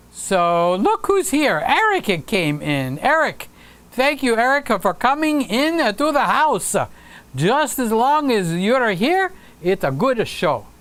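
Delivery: hammed-up Italian welcome, stretched and swooping; Italian accent. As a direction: put on an Italian accent with added vowels after consonants. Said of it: "That was the Italian accent".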